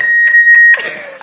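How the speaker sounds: shrill squealing mock laugh; annoying noise. Squirrel makes its annoying noise, a shrill squeal. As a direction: make the most irritating noise you can. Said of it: "the most annoying noise in the world".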